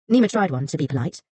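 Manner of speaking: ordinary delivery, nothing marked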